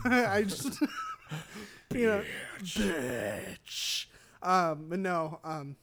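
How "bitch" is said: lower, long drawn-out mock groan; shot game enemy. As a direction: stretch the word out as if fading from a bullet. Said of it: "when he gets shot".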